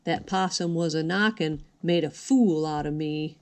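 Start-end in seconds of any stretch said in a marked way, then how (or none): none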